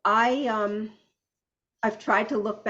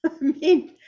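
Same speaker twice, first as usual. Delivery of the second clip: a real laugh breaking through, pitched up and louder; 8 semitones higher, 6 dB louder than usual. Real, not acted. Granddaughter laughing in shock at the family secret. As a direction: laugh it out in disbelief.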